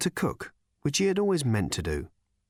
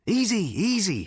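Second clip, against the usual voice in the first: soothing; 10 semitones higher than usual. Acted.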